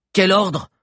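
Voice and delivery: loud, high demand; bold challenge. A narrator's reading. Bill boldly challenges the giant chief.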